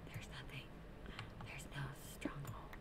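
unvoiced disappointed whisper. Real, not acted. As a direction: whisper it to yourself.

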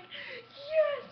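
very high cheering Yes; victory yell. Retold or imitated. Gamer cheering the winning goal, very high. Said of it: "I'm like".

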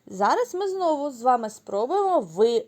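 normal talking voice

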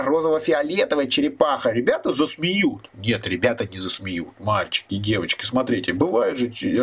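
ordinary delivery

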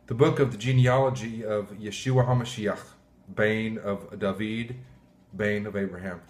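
scripture reading. Acted. Pastor reading Matthew aloud to viewers, a measured solemn scripture reading. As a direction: read the verse out like from the pulpit.

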